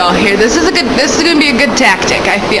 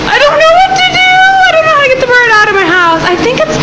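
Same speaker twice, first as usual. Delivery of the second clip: high, fast panicky voice; panicked; faster than usual. Real, not acted. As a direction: rush it out in a panic. Panicking over the bird.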